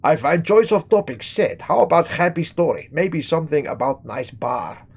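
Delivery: mock Eastern European accent, broken English; a lightly done Eastern European accent; correct voice. Shown as a demonstration. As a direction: read it as the Eastern European bar owner. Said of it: "let me read this in the correct voice".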